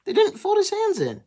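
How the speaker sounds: shocked high-pitched squeak of disbelief; high, loud, disbelieving squeal; surprised. Real, not acted. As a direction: jump way up in pitch, genuinely surprised.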